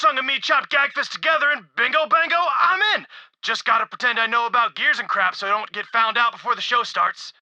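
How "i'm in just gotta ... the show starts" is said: ordinary delivery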